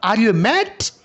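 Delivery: "Are you mad?" with the pitch climbing up on "mad"; rising intonation. Shown as a demonstration. Teacher showing a yes-no question tune. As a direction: ask it with a clear upward lift at the end. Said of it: "rising intonation বলতে পারি".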